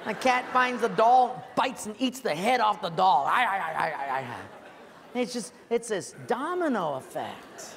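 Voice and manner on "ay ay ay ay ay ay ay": comic wail, slowing and fading into a laugh; wail, then trail off laughing; a doll's comic wail slowing into laughter. Retold or imitated. Doll losing its head.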